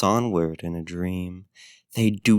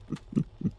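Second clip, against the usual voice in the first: breathy soft chuckle; 9 dB quieter than usual. Acted. Sensing her willingness.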